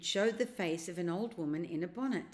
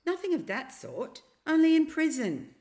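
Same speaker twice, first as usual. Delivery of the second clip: higher, louder, matter-of-fact correction; prim old uncle; 10 semitones higher, 9 dB louder than usual. A narrator's reading. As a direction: correct the boy as if it's nothing. Uncle Andrew correcting Diggory.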